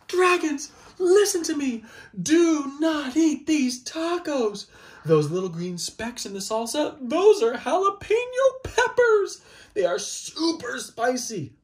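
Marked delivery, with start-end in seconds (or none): 0.1-4.7 much higher and louder, slow pleading command; frantic storybook warning; urgent warning. Pretend. 7.0-11.5 frantic storybook warning; urgent warning. Pretend.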